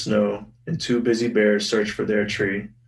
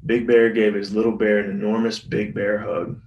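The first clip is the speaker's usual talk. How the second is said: ordinary talk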